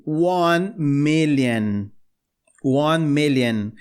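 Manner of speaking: One million slow, said melɐn; slowly, the I sounding like E. Shown as a demonstration. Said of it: "o I tem o som de E". (million com I soando como E)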